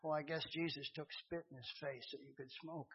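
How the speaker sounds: soft, hushed voice of the Spirit; still small voice. Retold or imitated. The Spirit of God rebuking a smoking Marine, softly. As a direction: speak it low and gentle, like a conscience. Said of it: "in that still small voice".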